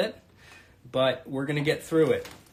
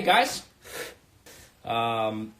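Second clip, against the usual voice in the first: normal talking voice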